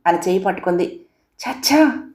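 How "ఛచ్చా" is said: much higher, indignant scoff; indignant exclamation. Acted. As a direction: dismiss it with a sharp exclamation. Rejecting her plea indignantly.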